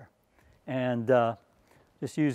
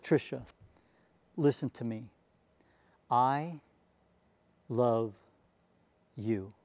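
softer and slower, each word set apart; tender, slow and soft; 4 semitones lower, 6 dB quieter, slower than usual. Retold or imitated. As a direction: comfort a crying girl gently, word by word. Tender words to crying girl.

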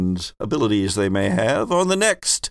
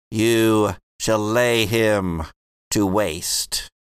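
slow, solemn prophetic chant; rhyming oracle; 4 semitones higher, much slower than usual. Acted. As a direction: intone the prophecy like a seer. Prophet's final rhyme.

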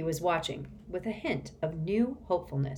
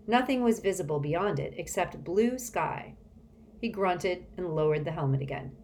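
usual speaking voice

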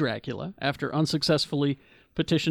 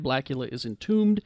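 ordinary talk

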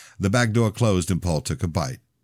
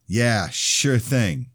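smug; 6 semitones higher, much slower than usual. Acted. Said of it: "took off with a smile".

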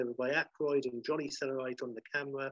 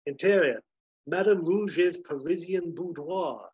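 raised, theatrical screenplay read; reading a script aloud; 5 semitones higher, 4 dB louder than usual. Acted. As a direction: savor each steamy stage direction. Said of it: "reading, says".

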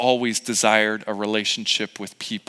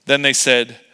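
ordinary delivery

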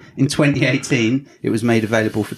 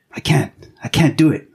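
desperate whisper; 5 semitones higher, 3 dB louder than usual. Acted. Detective pleading with haunting voice.

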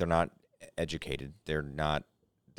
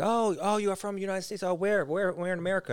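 much higher, lilting curious foreigner; mock European asking; 11 semitones higher than usual. Europeans asking where in America, high lilting mock voice. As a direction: sing it up high like a puzzled tourist. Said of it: "they're like".

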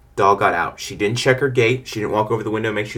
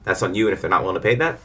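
normal talking voice

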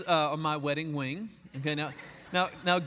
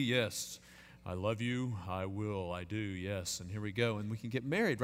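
low, quiet, steady groom's voice; composed groom; 9 semitones lower, 8 dB quieter than usual. Retold or imitated. Groom standing firm for his bride, composed. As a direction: play the firm, in-control man. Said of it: "stand st-, firm and have her come forward and kinda be".

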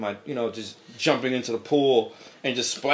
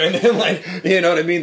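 laughing; 5 semitones higher, 7 dB louder than usual. Real, not acted. Laughing while telling story.

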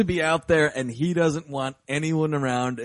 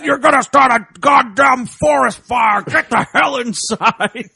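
louder, higher shouting scold, laughing; angry shout; 7 semitones higher, 7 dB louder than usual. Retold or imitated. Hermit throwing water on the fire and shouting at the kids, loud and angry. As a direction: bellow at the kids like a furious old man.

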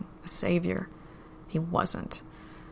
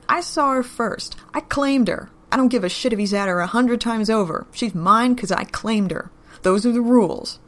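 1 loud claim; 4 semitones higher, 6 dB louder than usual. Acted. Len yells to keep Beth.

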